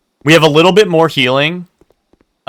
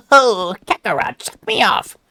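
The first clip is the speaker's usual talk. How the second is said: high, slow, sneering alien villain; Frieza voice; 7 semitones higher than usual. Acted. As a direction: purr it like a smug space tyrant. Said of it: "Frieza voice is more like".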